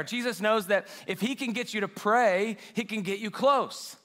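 normal talking voice

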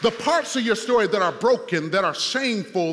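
preaching at full force. Acted. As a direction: build to a singing pulpit climax. Second climax of the sermon.

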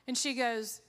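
ordinary talk

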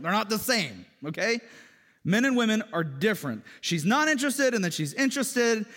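loud, high, impassioned sermon; full-force preaching. Acted. Pastor telling congregation men and women differ, fervent. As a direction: drive the point home.

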